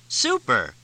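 bright, giddy burst of delight. A narrator's reading. Cindy clapping, thrilled at the joke, delighted cry. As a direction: cheer it out, tickled. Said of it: "Cindy began laughing again".

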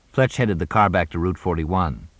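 ordinary delivery

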